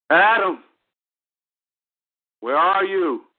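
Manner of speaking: booming high, calling from afar; voice of God. Retold or imitated. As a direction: call out to Adam, searching. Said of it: "was the next voice I heard".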